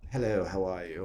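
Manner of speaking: Received Pronunciation greeting; RP accent. Shown as a demonstration. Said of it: "It's all in the R P accent".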